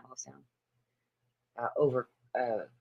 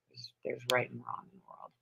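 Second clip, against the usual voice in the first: whispered aside; 4 semitones lower, 11 dB quieter than usual. Real, not acted. Muttered aside.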